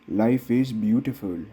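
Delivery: ordinary talk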